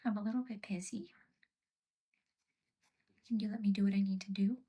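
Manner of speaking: whisper. Real, not acted. Busy, pet interrupting.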